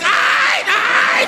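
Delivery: very high, shouted cousin. Retold or imitated. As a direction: answer up high like the pumped cousin. Cousin voiced in a story.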